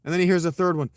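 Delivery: plain reading voice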